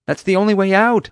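high, loud cry of alarm; urgent high-pitched shout; panicked yell. A narrator's reading. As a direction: yell out in desperation. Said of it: "I yelled".

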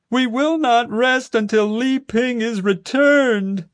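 high, slow, far-off rallying cry; proclaiming protester. A narrator's reading. Crazy Panda Guy rallying the crowd, slow high proclamation. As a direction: proclaim it like a fervent protest leader. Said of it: "Crazy Panda Guy proclaimed".

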